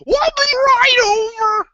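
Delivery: high sing-song mock of his friends. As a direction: parrot their empty promise in a silly high voice. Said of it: "It's like".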